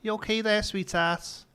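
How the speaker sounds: put-on high-pitched broad Scouse accent; older Scouse. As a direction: greet them like an old Liverpudlian, pitched right up. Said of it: "a lot of the older Scouse".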